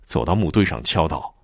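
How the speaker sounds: usual speaking voice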